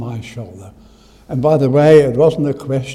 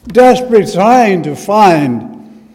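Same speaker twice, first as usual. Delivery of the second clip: emphatic preaching; 5 semitones higher, 9 dB louder than usual. Acted. Emphatic repeated sermon phrase.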